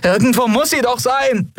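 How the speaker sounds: high, frantic cry; high-pitched desperate insistence; exasperated call. A narrator's reading. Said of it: "rief sie".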